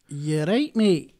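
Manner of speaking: best-attempt Scottish accent. Shown as a demonstration. Guest shows his best try, Scottish accent. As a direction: throw out a punchy Scottish phrase. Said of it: "That's my best".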